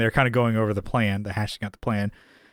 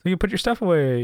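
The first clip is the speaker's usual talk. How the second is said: higher, questioning put-on voice; lightly imitated woman; 6 semitones higher, faster than usual. The pair arguing about going up to the apartment, lightly put-on high voice. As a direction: pitch up slightly for a back-and-forth quote. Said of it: "She's like".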